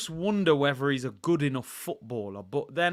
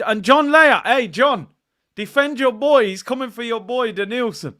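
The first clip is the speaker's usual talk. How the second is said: raised, high-pitched rallying shout; calling out; 7 semitones higher, 10 dB louder than usual. Real, not acted. Host hails John to defend his boy, loud calling out. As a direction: call out loud and high, goading.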